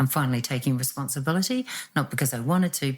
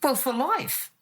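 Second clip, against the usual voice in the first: offhand, dismissive verdict; flippant retort; 10 semitones higher, slower than usual. Retold or imitated. Imitating the doctor's offhand verdict to his patient, flippant. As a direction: toss it off like it's nothing at all. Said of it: "He just flippantly said".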